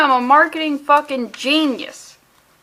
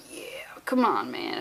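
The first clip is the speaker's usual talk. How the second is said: whisper; 13 semitones lower, 11 dB quieter, slower than usual. Retold or imitated. Aquaman voiced in comic panel.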